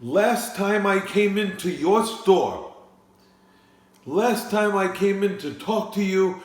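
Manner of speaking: a cranky politician scolding the wine seller, loud and high; Bernie Sanders impression. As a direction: harangue him like a fed-up candidate at a debate. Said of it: "Larry David's impersonation of Bernie Sanders".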